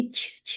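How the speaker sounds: "چ" as a short airy release; fronted "ch" sound. Shown as a demonstration. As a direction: push "چ" out at the front palate. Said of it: "یہ والی sound نکلے گی".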